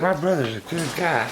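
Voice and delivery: high voice speaking for the pet; chirpy high pet voice; squeaky voice for the little monkey. Retold or imitated. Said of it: "he's like".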